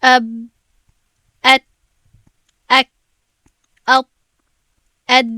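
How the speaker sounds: اب ات اک cut off hard with a closed stop; short abrupt syllables اب، ات، اک, each ending in a held stop; stopped consonants. Shown as a demonstration. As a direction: clamp each letter shut, no release. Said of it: "اگر من اینها رو تلفظ کنم".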